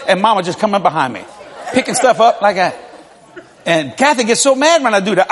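ordinary talk, nothing marked

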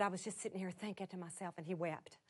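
hushed, very low, choked-back telling; soft, moved, almost whispering; real, near tears. Real, not acted. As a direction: hold back real tears as you speak. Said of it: "I'm about to cry telling you".

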